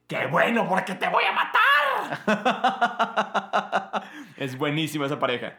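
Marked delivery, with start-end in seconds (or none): 0.1-1.6 high, loud mock-furious yell; a high, loud hammed-up cartoon threat. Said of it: "Y luego Trek le dice". 1.7-4.3 loud high laugh; a loud, high burst of laughter. Real, not acted. Laugh after quote.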